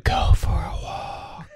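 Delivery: slow, unvoiced whisper from nowhere; eerie whisper. A mysterious voice whispers to Marty, slow eerie whisper. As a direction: breathe it like a ghostly voice in his head. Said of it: "the same voice whisper just saying".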